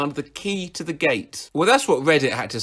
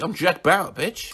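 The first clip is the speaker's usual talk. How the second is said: usual speaking voice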